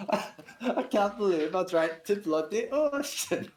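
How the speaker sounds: slow, high English warthog cracking up; laughing warthog Len. Acted. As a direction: lament while laughing in character. Warthog laments through laughter.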